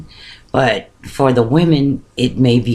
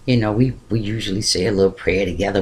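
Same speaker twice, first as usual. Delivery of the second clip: ordinary talk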